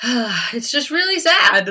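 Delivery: tearful. Real, not acted. Telling viewers about losing her best friend, tearful. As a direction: voice cracking with a sigh, close to crying.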